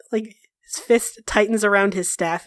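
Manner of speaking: normal talking voice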